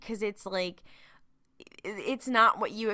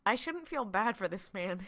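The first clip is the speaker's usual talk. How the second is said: usual speaking voice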